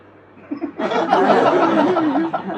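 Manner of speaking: big audience laugh; crowd laughter. Real, not acted. Audience reacts.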